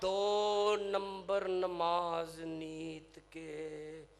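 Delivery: very slow, long-held mock wail; acted tearful lament. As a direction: drag the words out in a weeping chant. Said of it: "ਰੋਵਾਂ ਨਾ ਤੇ ਕੀ ਕਰਾਂ".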